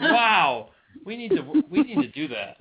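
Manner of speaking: high-pitched, wowed exclamation; amazed reaction. Retold or imitated. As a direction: retell being blown away, voice way up. Acting out amazed reaction to story.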